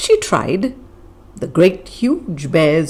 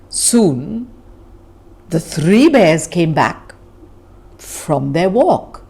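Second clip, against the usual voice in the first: ordinary delivery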